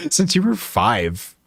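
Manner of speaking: amused laugh, drawn out slowly. Real, not acted. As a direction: chuckle through a slow correction. Laughs at own correction.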